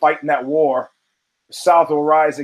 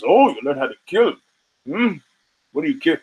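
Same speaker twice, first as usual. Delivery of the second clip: mock sarcastic. Mocking the guy's kill boast.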